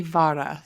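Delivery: "vara" with an open "ah" in the first syllable; "vara" Spanish-style, not like "Vera"; Spanish pronunciation. Shown as a demonstration. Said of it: "Well, in Spanish, it would be".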